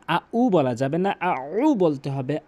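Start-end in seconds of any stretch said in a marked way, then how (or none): 1.2-1.7 আউ said slowly with pressure from the throat; pressed aʿu. Shown as a demonstration. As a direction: press hard on আউ. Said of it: "আউ চাপ দিয়ে বলবেন". (চাপ দিয়ে আউ)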